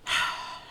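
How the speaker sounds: long tired breath out; weary sigh. Acted. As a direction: sigh like she's fed up. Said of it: "And she sighs".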